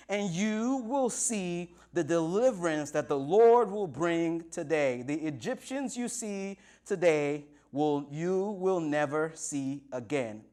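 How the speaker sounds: slow, emphatic preacher declaiming; steady a frightened crowd with measured words; solemn scripture proclamation. Acted. Reads Moses' answer as proclamation.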